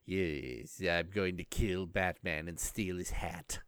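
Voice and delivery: low, soft, slow villain imitation; creepy villain voice. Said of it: "a great sort of creepy voice".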